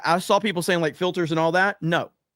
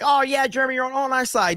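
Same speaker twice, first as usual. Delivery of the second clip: higher, a mocking sing-song of eager fans; mocking imitation; 8 semitones higher, faster than usual. Mocking the people who said he was on their side. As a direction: mimic the fans who claimed him. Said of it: "everybody like".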